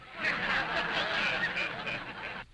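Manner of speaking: laugh. Acted. Ventriloquist laughing at dummy Otto.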